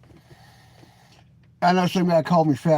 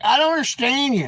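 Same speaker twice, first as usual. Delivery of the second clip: put-on bartender girl; 8 semitones higher, 3 dB louder than usual. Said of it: "she was like".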